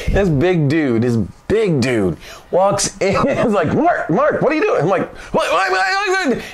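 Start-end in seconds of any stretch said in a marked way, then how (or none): 3.7-4.8 high-pitched startled squeal, imitated; voice going up. Boss walking in on Mark, startled high voice. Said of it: "And his voice goes up". 5.3-6.4 very high panicked squawked whats; chicken-like clucking. Retold or imitated. Mark answering the boss who walked in, high clucking panic. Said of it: "clucked at each other like chickens".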